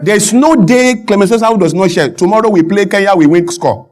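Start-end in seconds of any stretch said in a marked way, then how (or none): none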